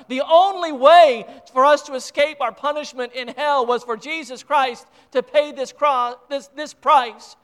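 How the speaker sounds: loud, rising pulpit fervor; preacher building to the punishment in hell; preaching at full force. Real, not acted. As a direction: drive home the price paid.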